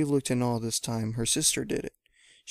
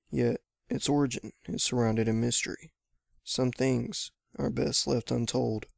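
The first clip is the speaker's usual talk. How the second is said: ordinary delivery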